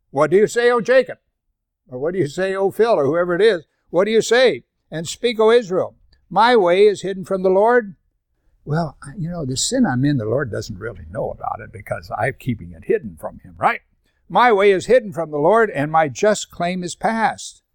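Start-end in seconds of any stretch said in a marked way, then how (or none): 0.1-8.0 loud, high scripture reading; preaching at full force. Acted. Pastor reading Isaiah to the congregation, loud and high. 14.3-17.6 loud, high, slowing at the end; preaching at full force. Acted. Pastor repeating the verse to the congregation, loud and high.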